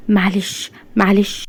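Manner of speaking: hushed, barely voiced apology; mouthed aside. A narrator's reading. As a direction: say it softly, as if only with the lips. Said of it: "وهي بتقول لها بشفايفها". (بهمس بالشفايف)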